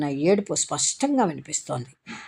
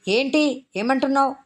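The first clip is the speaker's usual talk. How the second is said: puzzled irritation; 8 semitones higher than usual. Acted.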